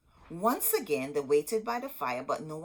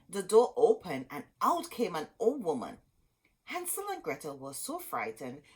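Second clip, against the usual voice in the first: plain reading voice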